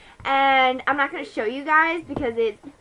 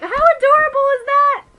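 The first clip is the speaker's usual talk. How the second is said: very high, loud, sing-out gush; gushing squeal; 12 semitones higher, 9 dB louder than usual. Acted. Storyteller gushing over a first-date proposal, in a high mock squeal. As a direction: squeal it with exaggerated delight.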